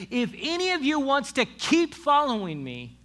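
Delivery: high, loud call to the crowd; ringing, emphatic proclamation; challenge to crowd. Acted.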